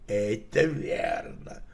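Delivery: plain reading voice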